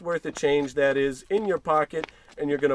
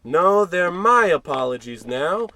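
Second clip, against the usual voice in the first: loud, slow, annoyed retort; irritated snap; 2 semitones higher, 8 dB louder than usual. Acted. George snapping back at a pesky clerk, loud and slow. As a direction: snap it back at him, drawn out.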